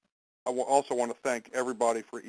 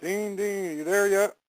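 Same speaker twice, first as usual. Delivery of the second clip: raised, higher call into the phone line; 9 semitones higher, 7 dB louder, slower than usual. Real, not acted. Host calling out to check if Dean has joined, raised voice.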